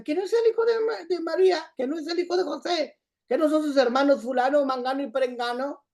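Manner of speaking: high-pitched mimicry of doubting townspeople; acted incredulous questioning. Voicing doubting townspeople questioning Jesus.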